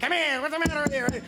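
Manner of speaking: put-on high-pitched old-movie leading man; 1930s guy's voice. Shown as a demonstration. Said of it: "old nineteen thirty guy's voice".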